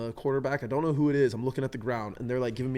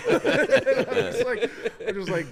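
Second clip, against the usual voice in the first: high, slow laughter through the words; laughing; 11 semitones higher, 7 dB louder, much slower than usual. Real, not acted. A kicker laughing about his own mistake.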